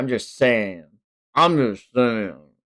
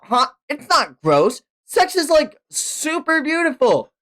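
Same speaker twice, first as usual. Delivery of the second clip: higher, pleading argument; lightly voiced higher Denji; eager Denji; 11 semitones higher than usual. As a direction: sell it like a horny teen. Denji arguing in character.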